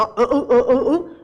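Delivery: repeated "an, an" pushed through the nose; nasal "fanho" grunts. Shown as a demonstration. Teacher playfully mimicking the nasal gym-goer, repeated nasal "an". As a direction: grunt a string of nasal "an" sounds. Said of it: "o fanho da academia".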